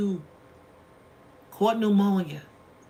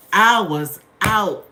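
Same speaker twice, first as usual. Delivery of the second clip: slow, much louder repeat; loud drawn-out emphasis; 11 dB louder, much slower than usual. Real, not acted. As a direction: stretch it out and hit it hard. Repeats the line for effect.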